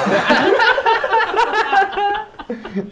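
big high belly laugh; loud burst of laughter. Real, not acted. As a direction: crack up hard. Hard laughter at memes.

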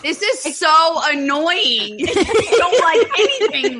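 laughing through the words; giggling exasperation. Real, not acted. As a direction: tease her while cracking up. Laughing teasing friend.

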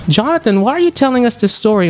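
mock listener voice. Asks himself as listener.

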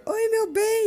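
wife coming home greets her husband, high and sweet; sweet greeting. Retold or imitated. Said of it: "Bia chega mais cedo do trabalho".

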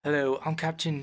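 put-on cockney accent. Guessing how the story's captain sounds, cockney accent. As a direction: try a British captain with cockney vowels. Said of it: "He's pretty cockney".

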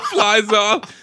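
ordinary delivery

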